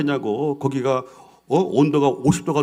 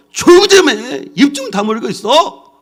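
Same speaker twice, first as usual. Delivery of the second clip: very high, loud hush, slower at the end; Caleb shouting; 8 semitones higher, 7 dB louder than usual. Acted. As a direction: snap the crowd silent. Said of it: "뭐라고 얘기했다고요?".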